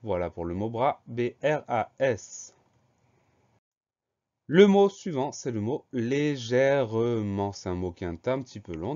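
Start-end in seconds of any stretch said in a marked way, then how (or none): 1.1-2.5 "B R A S" one letter at a time; slow, spaced-out dictation of each letter; spelled out slowly. Shown as a demonstration. Teacher spells the word out. 5.9-7.5 "légèrement" stretched slowly as lé-gè-re-ment; slow, separated syllables on "légèrement". Shown as a demonstration. New word dictated syllable by syllable.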